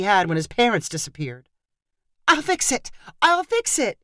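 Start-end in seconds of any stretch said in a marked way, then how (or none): 2.3-2.9 high, urgent cry; aunt calling to a screeching nephew; cried out. A narrator's reading. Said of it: "Megan cried". 3.2-4.0 higher, pleading repeat; cried out, more urgent. A narrator's reading. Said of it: "Megan cried".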